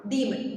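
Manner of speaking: Demon with the long ee stressed. Shown as a demonstration. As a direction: lean on the ee of demon. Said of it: "ഈയിൽ നമ്മളൊരു stress കൊടുക്കണം".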